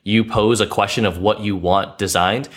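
ordinary talk

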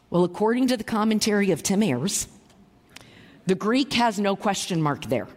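ordinary talk

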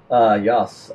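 put-on low, tentative Russian accent. Host warming up as the Russians, low Russian accent. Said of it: "I gotta get my Russian accent going".

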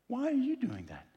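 usual speaking voice

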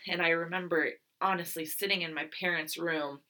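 ordinary delivery, nothing marked

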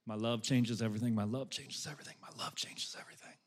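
fading from soft to a whisper; holding a child, barely murmuring; silent inner mantra. Shown as a demonstration. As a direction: repeat it under your breath, dying away. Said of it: "I wouldn't say anything out loud".

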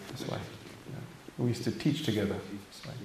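quick unvoiced side remark off-mic; whispered aside. Real, not acted. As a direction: drop to a near whisper for a private word. Aside to a colleague mid-talk.

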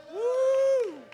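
high, hollow cry of excitement; high whoop. Real, not acted. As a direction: let out a preacher's whoop. Preacher's exclamation.

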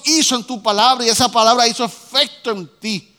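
loud, rising sermon cry; preaching at full force. Acted. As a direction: push the words out to the whole church. Predica con fuerza. (predicación fuerte y elevada)

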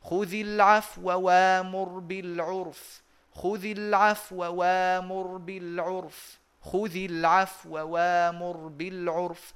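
slow recited خذ العفو وامر بالعرف with the waw stolen short; Quranic recitation. Shown as a demonstration. Teacher reciting the verse as a model for students. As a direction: chant it slowly, slipping the waw. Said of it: "فأقرأها هكذا".

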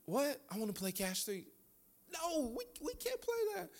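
high, soft mock-protest voice. Dad answering the boys about Cash Three, high hushed protest. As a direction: squeak out a hushed objection. Acts out reaction to kids' request.

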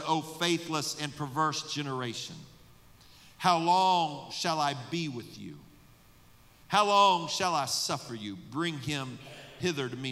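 lightly voiced Jesus rebuking; scripture reading. Preacher voicing Jesus to his disciples, solemn. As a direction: read the rebuke with measured weight.

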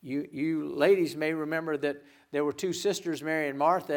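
ordinary talk, nothing marked

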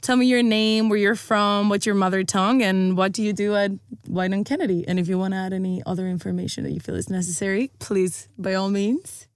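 normal talking voice

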